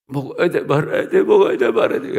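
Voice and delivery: frantic should-I-eat-it voice that climbs to a high cry; panicked inner dilemma. Retold or imitated. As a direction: spiral into frantic indecision. Panicked inner voice over apple.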